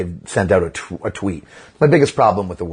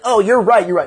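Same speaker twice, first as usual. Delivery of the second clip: high, louder sing-along agreement; exaggerated agreeing, pitched way up; mock agreement; 11 semitones higher, 4 dB louder than usual. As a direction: imitate eager yes-men. Mimics everyone agreeing.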